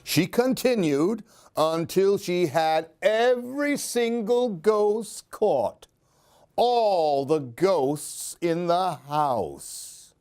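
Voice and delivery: put-on lively read-aloud for a child; expressive children's story reading; storybook narration. A narrator's reading. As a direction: tell it like a bedtime picture book. Reads picture book aloud.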